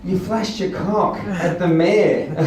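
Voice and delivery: slow, laughing reveal. Retold or imitated. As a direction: deliver the punchline through a laugh. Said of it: "they're laughing".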